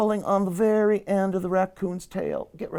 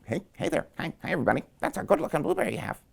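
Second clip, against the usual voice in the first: rabbit; 8 dB quieter, much faster than usual. Acted. Rabbit greets the animals, quick soft chatter. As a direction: chatter softly and fast like an excitable rabbit. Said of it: "And the rabbit came up".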